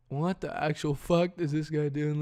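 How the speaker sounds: usual speaking voice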